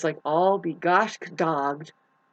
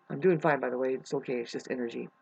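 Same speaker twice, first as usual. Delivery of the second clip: dropping to a soft, low murmur; soft, steadying hush; quiet self-reassurance; 6 semitones lower, 7 dB quieter, faster than usual. Real, not acted. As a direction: settle yourself down, almost to yourself. Calming herself after the rant.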